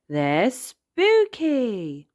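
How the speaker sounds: high, slow stretch on "spooky"; spooky emphasis. A narrator's reading. Child correcting grandpa, "spooky" drawn out. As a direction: draw out the first vowel of "spooky", high.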